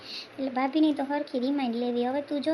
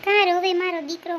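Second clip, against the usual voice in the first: louder, calling out to her son; loud consoling call; 3 semitones higher, 7 dB louder than usual. Acted. Mother calling out to her weeping son, loud and consoling. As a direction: raise the voice to reach a crying son.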